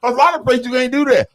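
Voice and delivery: ordinary talk, nothing marked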